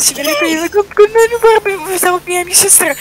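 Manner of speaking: high, loud mock-threatening call; shrill playful calling. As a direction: call after her in a high play voice. Pretend chase calling Senorita.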